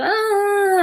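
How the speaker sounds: high drawn-out mock whine; high mock bawling; childish wail. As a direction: imitate a kid's long "ahh". Retells childhood whining before grandmother.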